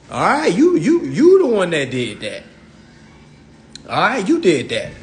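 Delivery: normal talking voice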